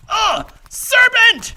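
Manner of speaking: very high, loud, slow cry of outrage; outraged shriek. Acted. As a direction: let it burst out in horror. Pigeon spots the serpent again.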